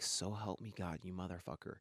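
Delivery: low, hushed cursing; muttered threat. Retold or imitated. After a shoulder ram on the street, muttered threat.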